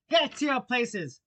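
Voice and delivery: loud, high, drawn-out command; thundering shout. Acted. Queen starting the game, thundering shout. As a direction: thunder the order to a crowd. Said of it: "in a voice of thunder".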